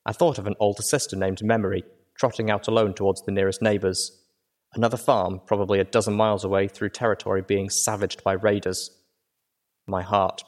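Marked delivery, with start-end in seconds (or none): none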